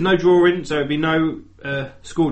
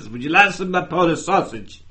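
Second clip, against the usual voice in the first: higher, louder mock Polish accent; badly faked Polish man's accent; attempted Polish accent; 4 semitones higher, 5 dB louder than usual. Neighbour offering his sausage every night, attempted Polish accent. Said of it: "if a Polish man speaks like that".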